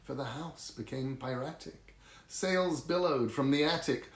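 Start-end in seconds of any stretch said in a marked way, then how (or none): none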